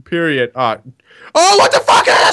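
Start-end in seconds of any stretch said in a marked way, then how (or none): 1.3-2.3 high loud startled cry; shocked yell. Real, not acted. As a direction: blurt it out in shock. Sudden death in game.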